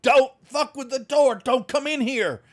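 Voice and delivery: high, loud panicked warning; cornered doctor near hysteria; shaking, terrified doctor. Acted. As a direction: scream the warning, trembling with fear. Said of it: "he's shaking and all that".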